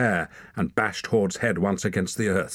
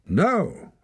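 high, ringing objection; ringing cry; 13 semitones higher, 3 dB louder than usual. A narrator's reading. Girl objects to freeing the boy, ringing cry. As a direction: cry out to stop them. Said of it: "a girl's voice rang out".

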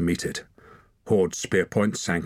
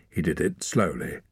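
plain reading voice

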